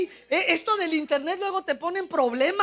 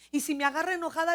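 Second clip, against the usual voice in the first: ordinary talk, nothing marked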